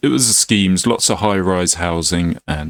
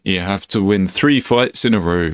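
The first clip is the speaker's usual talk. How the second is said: usual speaking voice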